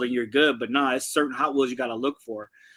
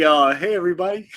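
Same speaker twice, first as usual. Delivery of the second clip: normal talking voice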